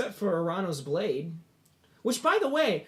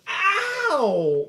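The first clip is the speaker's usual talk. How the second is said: high-pitched yelp; 13 semitones higher, 8 dB louder than usual. Acted. GM voices NPC hit by bowl.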